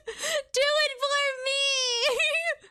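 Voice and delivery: high, slow, laughing begging; laughing plea. Real, not acted. Begging a friend through laughter, laughing plea. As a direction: beg through giggles.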